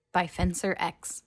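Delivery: usual speaking voice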